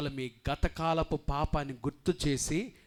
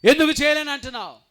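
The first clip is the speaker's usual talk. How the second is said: loud high plea, fast; pleading shout; 12 semitones higher, 14 dB louder, faster than usual. Acted. Husband begging his wife why, loud and fast. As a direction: demand an answer from his wife.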